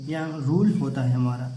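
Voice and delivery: normal talking voice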